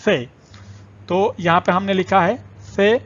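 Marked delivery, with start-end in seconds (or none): 0.0-0.2 slow, careful سے with a lisped th; Arabic th sound. Shown as a demonstration. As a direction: breathe سے out as the English "th" in think. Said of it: "تو یہ اس کی اصل آواز ہے".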